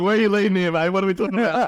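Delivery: high, playful put-on suspicion; mock suspicious. Answering a mate's teasing, high mock suspicion. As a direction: act wary of the setup, voice up high. Retelling a suspicious reply to a tease.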